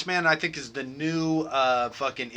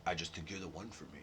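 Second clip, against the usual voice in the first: much lower, very soft smooth line; slick dub voice; 10 semitones lower, 18 dB quieter than usual. Retold or imitated. Friend mocking the English dub, low and slick. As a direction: play it slick and smooth, a cool operator. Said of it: "just all slick".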